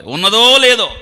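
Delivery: ఉన్నదో held long, high and very loud; loud rising swell on ఉన్నదో, falling after; drawn-out emphasis. Real, not acted. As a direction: hammer the phrase home, stretching ఉన్నదో. Repeats the phrase for emphasis.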